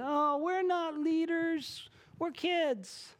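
put-on whiny kids. Kids protesting to their mom, mock whiny high voice. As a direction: whine like a kid dodging a chore.

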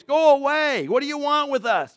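loud high cry; a preacher reading a demon's cry out loud. A narrator's reading. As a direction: cry out at the top of your voice. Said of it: "he cried out at the top of his voice".

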